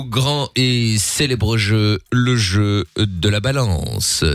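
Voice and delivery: very slow, low grand announcement; game show announcer. Acted. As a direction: announce it like a solemn spectacle.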